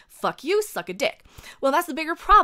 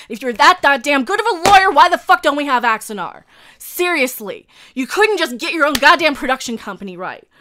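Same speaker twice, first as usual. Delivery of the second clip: loud, higher-pitched furious challenge; unplanned angry outburst; 3 semitones higher, 9 dB louder than usual. Real, not acted. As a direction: explode at a know-it-all. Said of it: "that wasn't planned".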